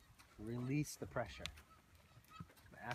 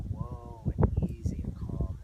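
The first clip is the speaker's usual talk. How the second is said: whispery soothing whoa; easy, calming; 8 dB louder, slower than usual. Shown as a demonstration. Trainer showing calming words, whispered and easy. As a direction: breathe it out to calm a horse. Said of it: "lowering their voice".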